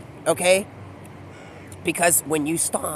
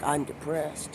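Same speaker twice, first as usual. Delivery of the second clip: mock depressed; 5 semitones lower, 11 dB quieter, slower than usual. Voices a character declaring depression.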